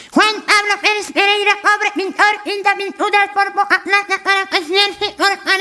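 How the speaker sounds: high, mangled speech of a cleft-lipped man. Acted. Cleft-lipped villager shouts a twister at the echo, high and mangled. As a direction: force the twister out high through a cleft lip. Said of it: "él era boquinche".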